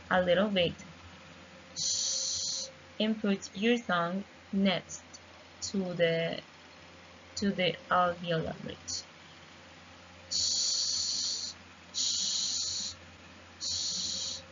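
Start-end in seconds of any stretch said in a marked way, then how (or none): 1.8-2.7 "shh" hissed softly with rounded lips; sh sound. Shown as a demonstration. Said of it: "So it is like this". 10.3-14.4 "shh" hissed softly with rounded lips; sh sound. Shown as a demonstration. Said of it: "this sound is voiceless".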